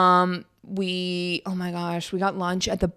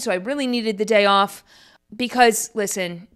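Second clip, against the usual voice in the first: usual speaking voice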